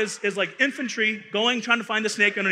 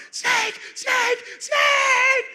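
piercing high screams, much louder; screaming; 3 dB louder, much slower than usual. Retold or imitated. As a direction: shriek it in panic. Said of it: "screaming at the top of her lungs".

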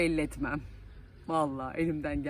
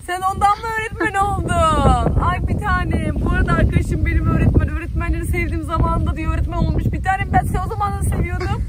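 gushing excitement; 11 semitones higher, 12 dB louder than usual. Real, not acted. Teasing friend who became teacher.

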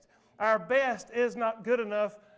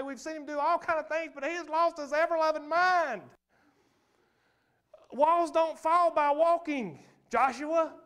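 higher and louder, the last line slowed right down; full-force preaching; 5 semitones higher, 3 dB louder than usual. Acted. Pastor retelling Jericho's march to the crowd, full preaching. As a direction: build until you're calling it out.

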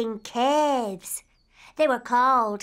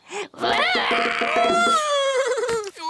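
wild shrieking laugh; 13 semitones higher than usual. Acted. Wild cave girl cackle.

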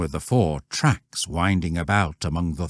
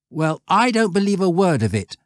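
higher, defiant schoolboy scoff; scoffing boy; 11 semitones higher, 4 dB louder than usual. A narrator's reading. Stanley scoffing at the shepherd, higher and defiant. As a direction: toss it off like a cocky boy.

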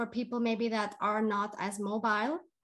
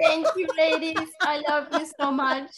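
higher and louder, crying through the words; real crying thanks; 5 semitones higher, 7 dB louder than usual. Real, not acted. Guest thanking her friends through tears, real crying. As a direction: thank them while the tears come. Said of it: "so I can stop crying".

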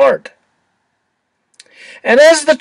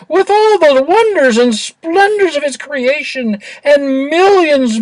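high, eager little angel; sweet bubbly cherub voice; 8 semitones higher than usual. A narrator's reading. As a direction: squeak it out like a cherub.

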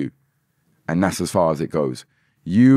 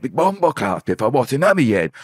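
internet guy quote; 6 semitones higher than usual. Retold or imitated. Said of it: "this guy on the internet".

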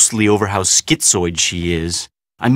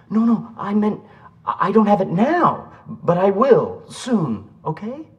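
anxious placating; 9 semitones higher than usual. Acted. Backtracking to demanding girlfriend.

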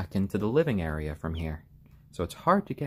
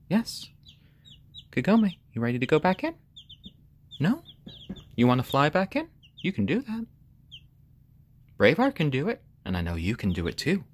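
higher, louder coaxing voice; coaxing pet talk; 7 semitones higher, 3 dB louder, faster than usual. Real, not acted. Owner coaxing the chicken to fly back in, higher encouraging pet talk. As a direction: urge the bird on cheerfully.

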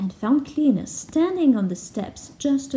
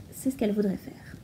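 whispered aside; 2 semitones lower, 9 dB quieter, faster than usual. Real, not acted. Teacher's aside.

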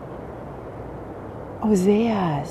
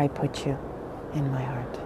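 soft whispered ending; 6 semitones lower, 11 dB quieter than usual. Acted. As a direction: breathe it out like a secret. Tender line of a love quote.

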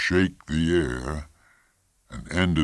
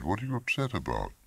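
quick, higher, hushed question; probing wife; 6 semitones higher, 6 dB quieter, faster than usual. A narrator's reading. Wife pressing her husband about his upset, quick and soft. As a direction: press him quickly and softly.

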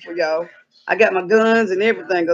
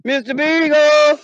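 drawn-out call; 5 semitones higher, slower than usual. Acted. Owner calling her lost dog through the woods, slow drawn-out shout. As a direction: stretch the name out like calling far away.

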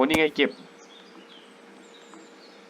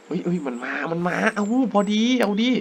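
excited; 5 semitones higher than usual. Real, not acted. Animal charging at him.

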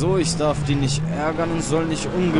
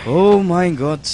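high, loud startled cry; shocked shout; 6 semitones higher, 11 dB louder, slower than usual. Real, not acted. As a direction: yell out in shock. Startled by attack.

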